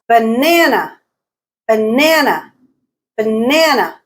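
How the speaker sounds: banana with NA in the middle long and strong; middle-syllable stress. Shown as a demonstration. Teacher quizzing learners on stress, banana stressed on middle NA. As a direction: lean on the second syllable of banana, then fall. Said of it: "pick out which syllable is stressed".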